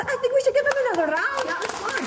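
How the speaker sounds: high, fast laughing praise. Real, not acted. Host laughing as she praises the crowd. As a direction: laugh through it quickly.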